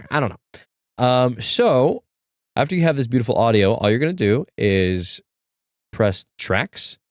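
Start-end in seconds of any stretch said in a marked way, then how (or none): none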